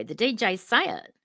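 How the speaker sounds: ordinary talk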